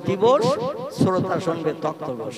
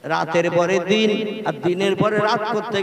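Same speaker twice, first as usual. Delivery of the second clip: loud preaching at full force; preacher rousing the listeners at full volume; fiery sermon; 5 dB louder than usual. Acted. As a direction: boom it out to a big open-air crowd.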